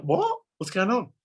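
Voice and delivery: high-pitched, incredulous question; mock bewilderment, voice jumping up; baffled. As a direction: act out someone caught totally unawares. Acts out bandmate's baffled reaction.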